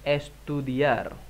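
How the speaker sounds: estudiar stretched slowly; slow model word. Shown as a demonstration. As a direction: draw estudiar out syllable by syllable. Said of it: "Vamos, estudiar".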